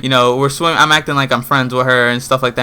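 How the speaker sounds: ordinary talk, nothing marked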